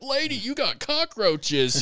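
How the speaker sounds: high, drawn-out mock complaint; mock call-out. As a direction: call her out, high and slow. Mock blunt remark to homeowner.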